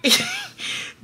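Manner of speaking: squealing giggle; high laugh. Real, not acted. Laughing at baby's face.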